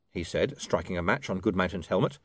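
ordinary delivery

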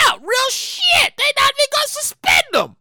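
ordinary talk, nothing marked

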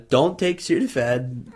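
ordinary delivery

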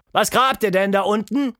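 loud, high shouted question; father calling down. A narrator's reading. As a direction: call curiously down to the yard. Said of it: "Dann fragte ihr Vater noch". (laut hinuntergerufen)

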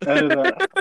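high, laughing plea; cracking up while asking. Real, not acted. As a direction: squeeze the words out through laughter. Laughing at own slip.

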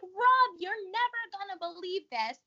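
mock yell across the room to her husband; imitating a scream. Mom calling to dad about the news, mock yell. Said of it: "she screams at my dad".

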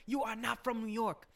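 high, drawn-out mock indignation; imitated scolding. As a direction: mimic an indignant telling-off, pitched high and slow. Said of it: "And he's like".

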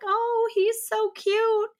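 high, slow doting coo; cooing older couple. Retold or imitated. Older couple admiring the toddler, high slow cooing. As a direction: coo over the baby like a grandma. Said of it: "they're like".

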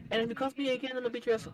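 high-pitched mock mom warning; imitated threatening mom. Acts out mom's threat.

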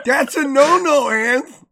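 loud, high playful telling-off; mock scolding. Real, not acted. Friend loudly mock-scolds Anthony for climbing a tank.

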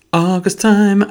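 high-pitched, drawn-out playful echo. Pretend. Echoing own words about summer, playfully high. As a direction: lift it way up and hold it.

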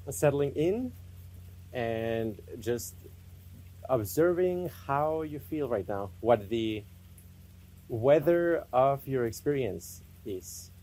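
spacious, unhurried meditation guidance; guided meditation. Real, not acted. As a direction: lead them inward unhurriedly, phrase by phrase.

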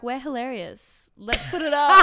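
hammed-up boastful cheer; mock cocky. Mock confident inner voice.

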